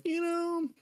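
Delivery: high-pitched put-on voice, stretched out; sheepish mock admission. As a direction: squeak out a mock confession, holding back a laugh. Mock quote of company's admission.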